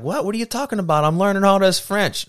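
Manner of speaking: put-on high-pitched protesting kid voice; indignant young self. Teen protesting to grandma about his French, high and indignant. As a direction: protest like a confused teenager. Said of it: "I was like".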